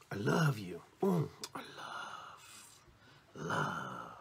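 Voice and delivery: breaking from voiced into a low, slow hush; savoring love. Shown as a demonstration. Speaker demonstrates loving words, slow and hushed. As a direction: linger on love, sinking softly.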